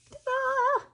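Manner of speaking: 2 a high, sing-song "Ta-da!" reveal; 3 present it like a magic trick; 4 high, bright reveal call; 1 showy ta-da. Real, not acted. Presenting the finished step.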